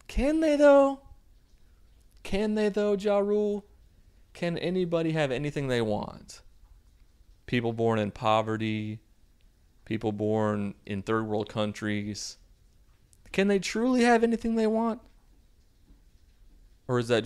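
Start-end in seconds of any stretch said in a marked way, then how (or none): 0.1-6.4 very high, squeaky-pitched questioning; mock challenge. Host challenging [name]'s line on limits, mock challenge. 13.3-15.0 high-pitched mock questioning; mock challenge. Host doubting anyone can have anything, mock challenge. Challenges movie line again.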